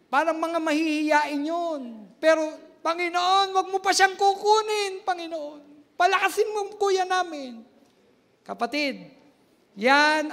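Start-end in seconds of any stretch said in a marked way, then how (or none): 2.8-7.7 loud, high pleading prayer; retold prayer. Members praying for their pastor, loud and pleading. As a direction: beg God for his life.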